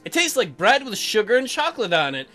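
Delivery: usual speaking voice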